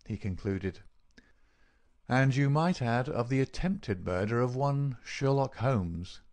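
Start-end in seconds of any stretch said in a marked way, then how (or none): none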